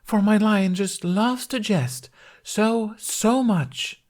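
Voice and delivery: high, slow, overdone reassurance; awkward cover-up. A narrator's reading. Knight excuses the jesting lion, awkward and high. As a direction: stretch it out in a stagey high voice. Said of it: "laughing awkwardly".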